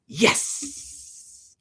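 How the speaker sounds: breathy high cheer; little victory yelp; excited. Real, not acted.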